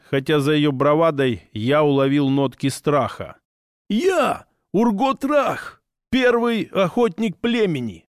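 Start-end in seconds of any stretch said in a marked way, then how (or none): 3.9-8.1 high, slow, boastful orc hunter; proud orc voice. Acted. Orc introduces himself proudly.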